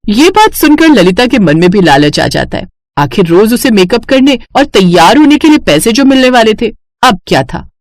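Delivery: ordinary delivery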